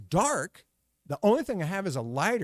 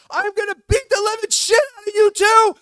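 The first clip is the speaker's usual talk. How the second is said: high, full-volume threatening shout; furious yelling; 15 dB louder than usual. Retold or imitated. Enraged driver climbing toward two boys, yelling. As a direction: yell it like an enraged man charging up. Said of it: "he is yelling".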